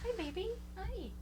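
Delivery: high, soft talk to a pet. Real, not acted. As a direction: coo at the dog. Talking to the blind dog.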